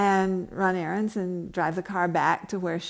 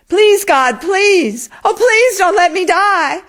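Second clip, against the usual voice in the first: much higher and louder, a terrified wailing prayer; desperate sobbing plea; 12 semitones higher, 13 dB louder, slower than usual. Acted. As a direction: beg for your life through sobs. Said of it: "sobs die back".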